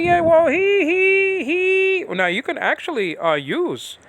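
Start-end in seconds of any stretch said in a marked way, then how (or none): 0.0-2.0 very high, drawn-out mimicry; mocking imitation. Teacher mimics what opponents say about "he", high mocking voice. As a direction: mimic them in a high, stretched-out voice.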